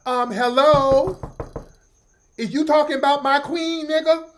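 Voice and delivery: high, loud offended fan; sassy caller defending his queen; hammed-up indignant fan voice; mock super fan. Imitating super fan calling in.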